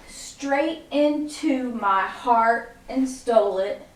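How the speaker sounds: very slow, drawn out to the moves; slow counted lyric. Shown as a demonstration. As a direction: stretch the lyric across the beats. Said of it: "So it goes".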